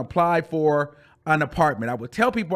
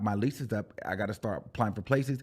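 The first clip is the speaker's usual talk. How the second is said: quiet worry; 7 semitones lower, 7 dB quieter, faster than usual. Retold or imitated. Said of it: "because I'm like".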